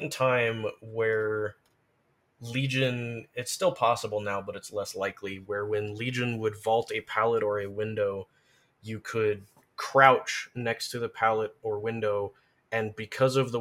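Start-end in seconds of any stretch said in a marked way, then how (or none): none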